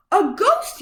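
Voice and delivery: high, startled yelp; frightened yelp. A narrator's reading. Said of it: "yelped one".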